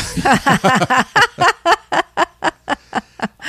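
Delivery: high, sustained burst of laughter; real helpless giggling laugh; long laugh. Real, not acted. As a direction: crack up at the absurd question. Laughing at the mankini question.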